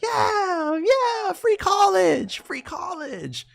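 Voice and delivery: very high, slow mock wail of free college; mocking whiny voice. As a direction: ham it up like a whining fan begging for handouts.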